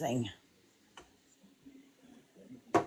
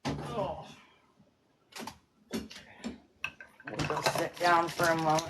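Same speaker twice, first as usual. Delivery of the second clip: ordinary talk, nothing marked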